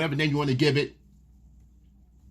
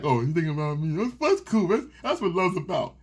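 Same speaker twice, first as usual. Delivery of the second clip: clueless husband. Retold or imitated. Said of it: "He like".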